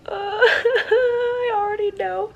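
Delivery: high, loud, drawn-out wail; half laughing, half crying, pitched high; tearful wail. Real, not acted. Reader who already knows what is coming, tearful wail.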